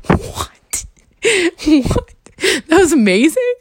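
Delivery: high, laughing what what; laughing disbelief. Retold or imitated. Reacting to his text about an amazing date, high laughing disbelief.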